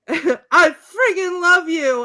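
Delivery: high, slow, loud declaration; imitated lovestruck shout; mock-passionate cry. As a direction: cry it out with hammed-up ardor. Acted declaration of love.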